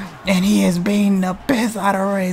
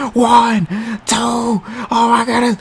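ordinary talk

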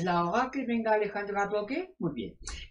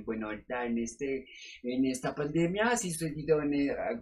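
ordinary talk

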